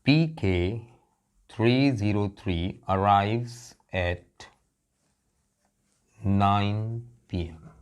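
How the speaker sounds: slow, measured airport announcer; lightly voiced flight announcement with spaced-out phrases. Teacher imitating an airport announcer for students, measured announcement. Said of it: "बड़ी सुरीली आवाज़ में announcement होता है".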